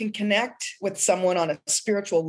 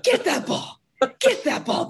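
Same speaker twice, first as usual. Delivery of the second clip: much higher, excited cheering for a dog; puppy pep talk; 12 semitones higher than usual. Pretend. Guest showing her pep talk to puppy Bootsy, high and excited. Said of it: "Only to my puppy".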